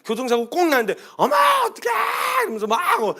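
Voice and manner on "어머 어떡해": high shrill panicked shout of a flustered woman; much higher mock panic yell; panicked cry. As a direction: shriek it in mock panic at the crash. Panicking at car accident.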